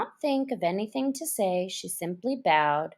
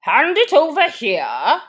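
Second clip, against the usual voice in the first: higher, louder command; dodo commanding; 7 semitones higher, 9 dB louder than usual. A narrator's reading. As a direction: bark it out like a pompous bird. Dodo voiced.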